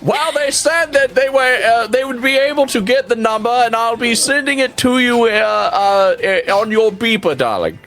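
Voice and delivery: doting dad voice. Acted. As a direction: play the flustered doting father.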